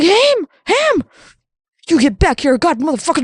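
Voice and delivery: high-pitched mock yell calling his son back; hammed-up furious dad yelling for Ham; God mock-yelling after Ham to get back here; mock angry father. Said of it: "he's like".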